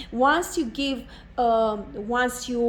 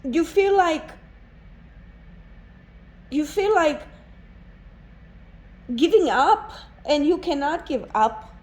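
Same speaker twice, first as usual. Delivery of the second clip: pushing through the urge to cry; real emotion catching the voice; near tears; 4 semitones higher than usual. Real, not acted. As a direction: voice rising and straining as emotion wells up. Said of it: "I don't know why I feel so emotional. I feel like crying".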